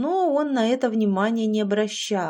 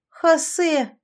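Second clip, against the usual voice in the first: higher, louder scoffing name; a scornful snort at the demon's name; snorting scornfully; 9 semitones higher, 6 dB louder than usual. A narrator's reading. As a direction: spit the name with a smirk. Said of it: "хмыкнула Яшка".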